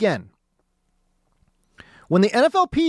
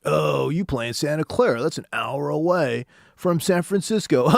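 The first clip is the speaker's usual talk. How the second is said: lower, sneering mock of an East Coast fan; 6 semitones lower than usual. East Coast fans taunting San Francisco, in a low mocking voice. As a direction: taunt like a smug rival fan. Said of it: "being like".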